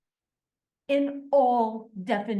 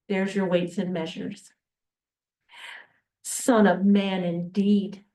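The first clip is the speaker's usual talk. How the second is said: usual speaking voice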